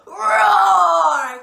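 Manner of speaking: full-force drawn-out roar; loudest lion roar. A narrator's reading. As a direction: roar as loud as you can. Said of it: "he roared as loud as he could".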